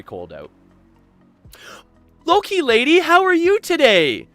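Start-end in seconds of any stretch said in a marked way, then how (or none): 2.2-4.3 high, loud, stretched greeting; excited greeting. Real, not acted. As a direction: sing out a happy hello. Greeting a viewer in chat.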